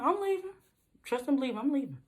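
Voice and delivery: high, sassy retort to mom; tossing it back with attitude; sassy reply. Retold or imitated. Answering mom with sass, pitched high.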